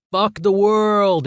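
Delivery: hammed-up mocking imitation; mock accent. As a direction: sneer it like a rebel's slogan. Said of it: "in a pansexual accent".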